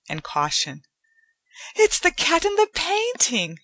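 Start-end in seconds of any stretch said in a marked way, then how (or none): 1.8-3.6 put-on high, louder young woman recognizing something; Joanna, amazed. A narrator's reading. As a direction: exclaim in wonder. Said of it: "Joanna said".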